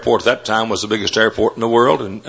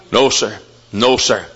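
normal talking voice

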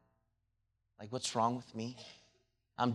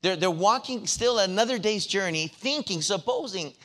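high, loud preaching at full force; preacher urging the young people in church, at full force; 9 semitones higher, 8 dB louder than usual. Acted. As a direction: drive the sermon home, louder and higher.

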